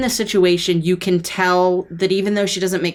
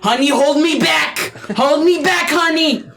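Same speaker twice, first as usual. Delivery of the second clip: loud high hammed-up hold me back; mock bravado; 8 semitones higher, 4 dB louder than usual. Snake husband hams up tough talk to the captive, mock bravado. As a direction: pretend you need restraining.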